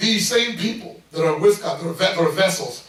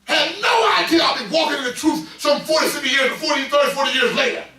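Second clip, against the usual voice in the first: full-force preaching; 6 semitones higher than usual. Acted. Preacher testifying about his baptism to the church, full-force preaching. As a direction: preach it to the rafters.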